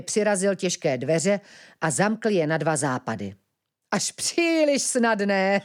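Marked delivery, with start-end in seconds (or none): 3.9-5.6 high, slow, drawn-out gloating; gleeful villain. A narrator's reading. Platón gloats over his trapped prisoners, gleeful. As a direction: crow over an easy catch. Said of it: "hýkal nadšeně".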